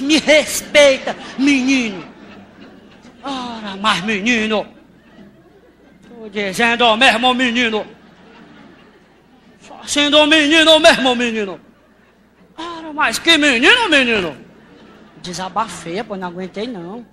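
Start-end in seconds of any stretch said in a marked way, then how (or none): 0.0-2.0 slow, loud laughing rebuke; stern scolding. Acted. Scolding the rude orange boy, slow loud mock-stern rebuke. 3.2-14.5 loud, slow, sing-song outrage; milk each word like a comic catchphrase; exasperated refrain. Acted. Venting to the audience about the boy, loud drawn-out exasperation.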